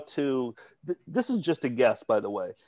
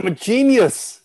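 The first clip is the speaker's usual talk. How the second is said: mock boast; 8 semitones higher, 7 dB louder than usual.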